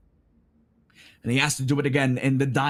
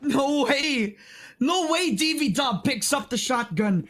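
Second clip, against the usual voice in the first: stunned disbelief; 6 semitones higher than usual. Real, not acted. Shocked reaction to play.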